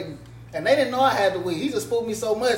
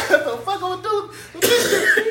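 laughing; 12 semitones higher, 4 dB louder than usual. Real, not acted. Laughing while retelling panic.

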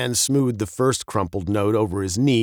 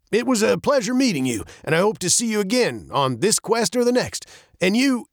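captain's farewell; 9 semitones higher than usual. Acted.

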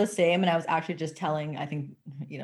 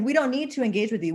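ordinary delivery

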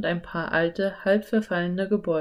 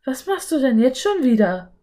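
exasperated question; 6 semitones higher, 5 dB louder than usual. A narrator's reading. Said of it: "fragte Anne".

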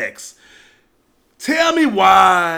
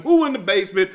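normal talking voice

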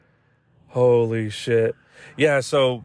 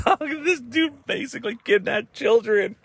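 high-pitched, incredulous laughing delivery; laughing disbelief; 11 semitones higher than usual. Real, not acted. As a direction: say it high while cracking up.

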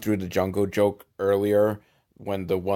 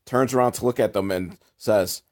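usual speaking voice

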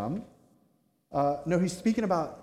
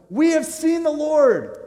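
high, loud, drawn-out announcement; excited proclamation; 11 semitones higher, 8 dB louder, slower than usual. Acted. Disciples telling Thomas they have seen Jesus, excited proclamation. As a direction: proclaim it slowly at high pitch and volume.

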